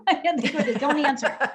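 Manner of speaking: laughing through the words; real laughter. Real, not acted. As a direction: giggle while pleading. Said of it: "laugh on lines".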